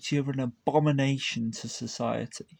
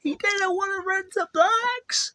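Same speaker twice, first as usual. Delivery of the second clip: much higher, louder disbelieving repeat; outraged high repeat; 5 dB louder than usual. Real, not acted. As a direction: squeal it in disbelief.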